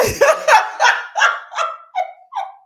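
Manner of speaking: long, loud high-pitched laugh; high cackling fit; real cackle. Real, not acted. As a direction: explode into helpless laughter. Cracking up at viewer's joke.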